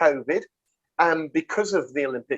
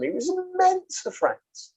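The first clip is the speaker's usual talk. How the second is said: gushing praise; 14 semitones higher, slower than usual. Real, not acted. Praising the French player.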